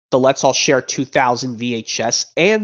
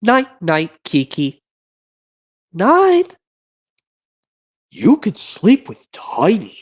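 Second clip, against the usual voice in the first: higher, slow cartoon voices; cartoon character voices; 6 semitones higher, 3 dB louder, much slower than usual. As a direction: lull the baby to sleep as the cartoon characters. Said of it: "It literally goes like this".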